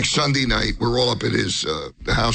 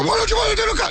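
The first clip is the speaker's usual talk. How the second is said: much higher, pushy squeak; high mock Sam voice; much faster than usual. Comic retelling Sam pushing coke, high mock voice. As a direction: needle him in a high put-on voice.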